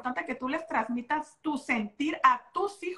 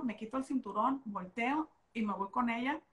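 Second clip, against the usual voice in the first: ordinary talk, nothing marked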